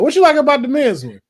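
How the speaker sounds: high, quick, loud mock questioner; playful high-pitched retelling; imitated eager question. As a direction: ask it fast and high-pitched, mimicking. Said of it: "and was like".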